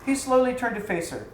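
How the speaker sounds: usual speaking voice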